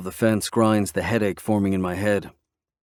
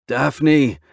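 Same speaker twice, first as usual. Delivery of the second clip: louder, higher, impatient bark of her name; 6 semitones higher, 5 dB louder than usual. Acted. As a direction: snap the name out, out of patience. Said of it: "I say with impatience".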